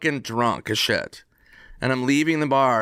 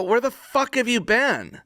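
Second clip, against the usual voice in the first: incredulous yell; 10 semitones higher than usual. Retold or imitated. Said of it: "I go".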